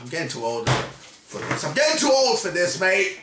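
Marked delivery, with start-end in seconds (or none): 0.0-1.6 put-on low, soft grumble of a tired old cop; a hint of a tired old man; weary movie line. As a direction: sigh it out like a worn-out veteran.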